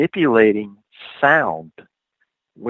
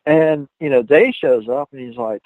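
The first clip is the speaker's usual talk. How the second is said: ordinary talk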